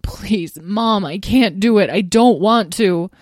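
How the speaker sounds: high, loud, whining plea; pleading young dragon. Acted. Azrael begging not to eat animals, whining desperately. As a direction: beg Mom in real desperation. Azrael begs his mother.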